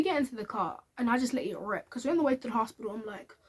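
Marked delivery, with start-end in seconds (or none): none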